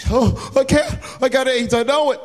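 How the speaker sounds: high, panicked victim voice; scared. Acted. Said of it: "he was so scared I had to say something".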